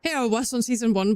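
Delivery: ordinary delivery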